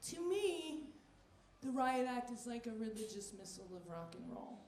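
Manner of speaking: ordinary talk, nothing marked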